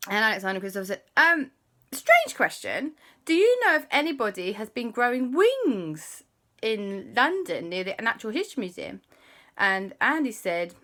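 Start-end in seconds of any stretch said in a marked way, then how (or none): 1.2-9.0 put-on high, eager kid voice; boys' voice. Alexander and Christopher offering to call Andy, high. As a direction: play the boys, pitched up.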